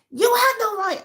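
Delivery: furious accusation cut loose; angry outburst. Retold or imitated. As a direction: snap it out high and hard. Angry confrontation retold on phone.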